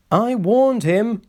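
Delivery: high, slow cross remark; told-you-so, annoyed. A narrator's reading. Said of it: "said Rusty crossly".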